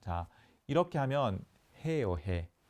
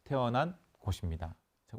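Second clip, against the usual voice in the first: ordinary talk, nothing marked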